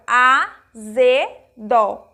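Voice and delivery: "azedo" split slowly into a, ze, do; syllable by syllable. Shown as a demonstration. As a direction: say "azedo" with a pause after each syllable. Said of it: "se eu pronuncio a palavra". ("azedo" pausado, sílaba por sílaba)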